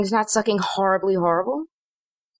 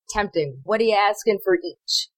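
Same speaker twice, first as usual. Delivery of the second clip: ordinary talk, nothing marked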